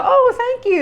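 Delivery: high, bright fake-polite thank you; mock polite thanks. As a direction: chirp it like a gift you secretly dislike. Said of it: "But some people go".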